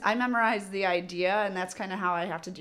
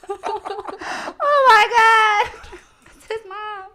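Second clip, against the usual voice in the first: high, loud, dragged-out laughing sob; fake-weep through laughter; hammed-up tearful wail, laughing; laughing mock cry; 11 dB louder, much slower than usual. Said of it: "And I was like".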